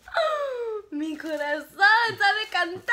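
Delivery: high, slow, loud swooning cry; mock swoon. Recalling falling in love, mock swooning cry. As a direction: gush it out like a love-struck heroine.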